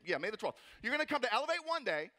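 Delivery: ordinary delivery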